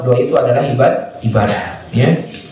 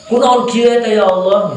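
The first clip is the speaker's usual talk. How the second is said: angry complaint; 5 semitones higher than usual. Shown as a demonstration. Preacher mocks an angry, demanding prayer. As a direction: complain like you're scolding heaven. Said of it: "Marah-marah teh siga ngadoa".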